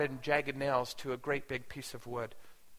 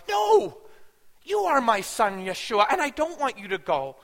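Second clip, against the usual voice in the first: anguished high, loud cry opening into pleading; screamed-out plea; 6 semitones higher, 5 dB louder than usual. Retold or imitated. Mother at the cross begging her son, crying out. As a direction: beg desperately, bursting out on the first word. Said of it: "wanted to scream out".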